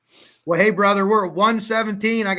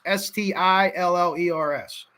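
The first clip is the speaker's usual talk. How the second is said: ordinary talk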